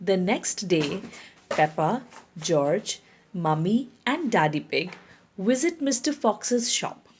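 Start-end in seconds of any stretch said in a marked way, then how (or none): none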